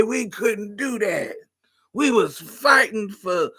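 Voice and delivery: drawn-out granny complaint; old lady voice. Pretend. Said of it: "I wanna be talking shit".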